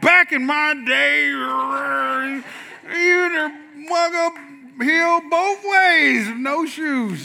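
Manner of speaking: lightly voiced old man, slow and drawn out; a hint of a grumpy grandpa lecturing; old-timer complaint. Preacher mimics an old man's back-in-my-day story, lightly voiced. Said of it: "I just got old enough to just say".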